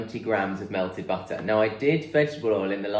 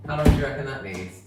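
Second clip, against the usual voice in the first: normal talking voice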